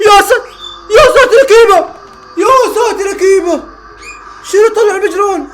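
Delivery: high, loud cry of disbelief; excited high shout. Real, not acted. Fan yelling at the goalkeeper's save, high and loud. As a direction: yell it out, stunned by the save.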